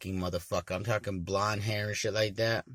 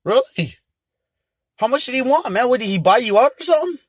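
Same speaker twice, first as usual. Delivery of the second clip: surprised friend; 12 dB louder than usual. Retold or imitated. Friend reacts to story.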